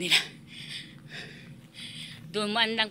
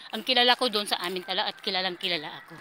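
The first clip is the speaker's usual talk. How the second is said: normal talking voice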